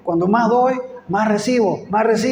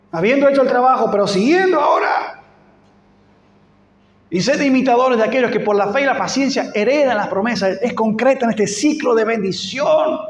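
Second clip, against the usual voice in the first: preaching; 3 semitones higher, 3 dB louder than usual. Acted. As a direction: build the appeal to the congregation. Preacher at full force.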